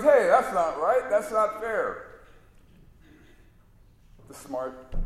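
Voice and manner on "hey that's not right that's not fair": higher, louder protest, slowing on not fair; a dim sidekick who feels cheated; whining that's not fair like a wronged kid; indignant sidekick. Retold or imitated. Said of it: "So he goes".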